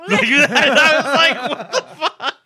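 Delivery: high, squealing laugh through the words; laughing shocked what; laughing disbelief. Real, not acted. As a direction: crack up in disbelief. Laughing at nephew's remark.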